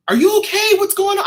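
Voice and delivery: high, loud imitation of worried colleagues; alarmed coworkers. Voices worried coworkers as she leaves.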